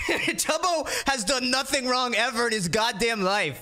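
joke-protective, chuckling as he says it; laughing defence. Real, not acted. Joking defence of Tubbo.

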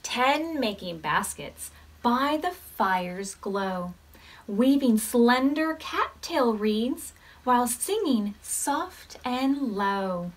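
gentle storybook read-aloud; reading to children. Acted. Teacher reading a counting story to the class, gently. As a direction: read it like story time for little ones.

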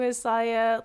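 usual speaking voice

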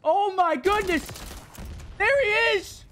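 excited shout. Real, not acted. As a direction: cheer like you just clutched it. Celebrating winning play.

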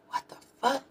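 high, hushed mock disbelief; retold disbelief. Reacting to neighbors finding themselves, high quiet disbelief.

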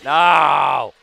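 long, loud cry of disbelief; outraged shout. Real, not acted. Player protests a safe call, outraged shout.

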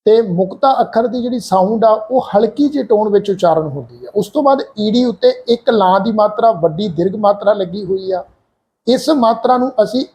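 normal talking voice

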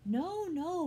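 high, loud refusal; urgent denial. A narrator's reading. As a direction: cry out in protest. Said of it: "Barnabas added".

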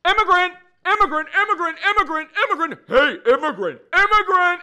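high, full-force repeated yelling; shouted taunts. Acted. People shouting at Nigel going to school, full-force taunting. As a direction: shout it over and over like hecklers. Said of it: "people would be shouting".

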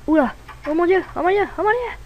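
rising panic, pitch climbing; panicked. Acted. Monsters coming, panicking.

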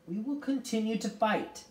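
high, ringing vow of resolve; resolute. A narrator's reading. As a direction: declare it to rally the children. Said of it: "Something in Miss Witch's voice".

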